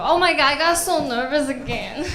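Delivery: ordinary talk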